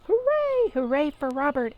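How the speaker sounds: high, loud hooray cheer; a crowd cheering for the hero. Acted. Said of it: "everyone yelled".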